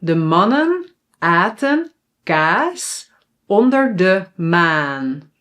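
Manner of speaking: slow, word by word, long A's drawn out; slow tongue twister. Shown as a demonstration. Teacher models a tongue twister for learners, slow and careful. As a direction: model it phrase by phrase with rising pitch. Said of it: "listen to me and then repeat after me".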